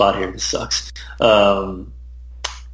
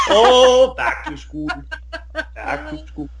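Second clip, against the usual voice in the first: bad impression climbing high, then trailing off; silly high-pitched ditty, hammed up; Arnold Schwarzenegger; 13 semitones higher, much slower than usual. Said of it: "Arnold Schwarzenegger as Billy Madison".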